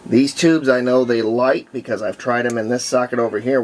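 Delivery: ordinary talk, nothing marked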